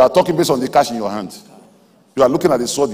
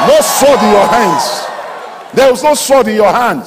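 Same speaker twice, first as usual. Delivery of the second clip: hammed-up taunting yell; mocking shout; 7 semitones higher, 6 dB louder than usual. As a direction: shout the doubt down at them like a scoffer. Preacher voices the scoffing doubter.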